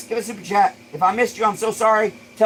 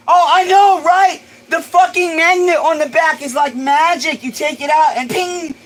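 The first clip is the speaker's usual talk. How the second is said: excited; 8 semitones higher, 3 dB louder than usual. Real, not acted. Gushing over the carb stand.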